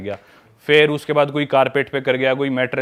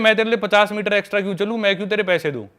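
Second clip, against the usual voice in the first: higher-pitched mock protest; indignant retold refusal; 7 semitones higher than usual. Friend mimics the girl refusing the errand, indignant. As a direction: imitate her put-out objection, pitched up.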